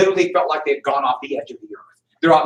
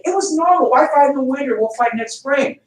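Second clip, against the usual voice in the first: lightly voiced smug British officer, higher; a touch of a dismissive redcoat, pitched up; mock British officer; 10 semitones higher than usual.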